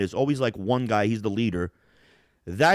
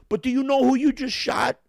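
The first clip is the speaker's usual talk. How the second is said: high incredulous soldier; 13 semitones higher, 3 dB louder than usual. Retold or imitated. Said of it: "And then he goes".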